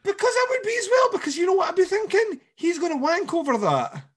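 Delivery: very high, loud, fast indignant rant. Real, not acted. [name] ranting about the killer, very high and loud. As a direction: shriek it out fast in mock outrage.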